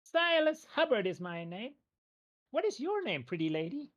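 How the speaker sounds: very high, slow creature introduction; squeaky-high, stretched-out creature voice; friendly tree spirit. Acted.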